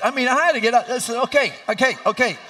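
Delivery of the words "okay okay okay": high, loud frantic begging; panicked pleading. Retold or imitated. Husband begging the doctor, high panicked plea. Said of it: "She got even more ferocious".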